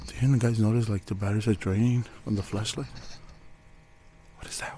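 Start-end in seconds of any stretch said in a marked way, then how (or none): 4.4-4.8 whispering. Real, not acted. Hushed in a dark abandoned building.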